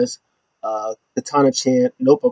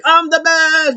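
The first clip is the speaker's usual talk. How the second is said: much higher bragging; mock boast; slower than usual. Champion boasting on the microphone, high mock brag. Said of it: "she's like".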